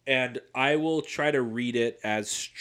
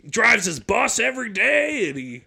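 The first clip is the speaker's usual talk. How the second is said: mock Bills fan; 10 semitones higher, 9 dB louder than usual. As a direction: ham up a gushing Bills fan, drawing it out. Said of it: "you'd be like".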